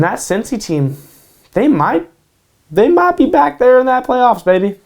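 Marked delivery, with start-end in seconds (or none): 1.5-4.8 higher and louder, fired up; hyped up. Real, not acted. Hyping the Bengals playoff chances, loud and excited. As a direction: pump up the playoff hopes.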